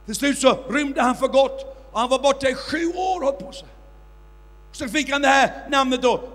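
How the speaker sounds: loud, rising preacher's storytelling; fiery preaching. Acted. Preacher recounting the miracle to his audience, fiery and loud. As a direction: drive the miracle story home to the crowd.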